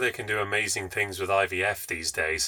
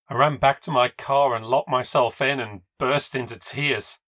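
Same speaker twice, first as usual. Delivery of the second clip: usual speaking voice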